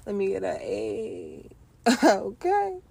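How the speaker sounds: laughing through the words, can barely get them out; giggling as she asks listeners for a yell. Real, not acted. Podcast host asking listeners for a sound, laughing.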